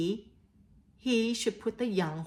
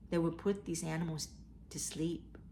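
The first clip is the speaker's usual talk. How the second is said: soft whisper, much quieter; 3 semitones lower, 8 dB quieter than usual. Real, not acted. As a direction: drop to a hushed whisper for the sad part. Grim fact told to children.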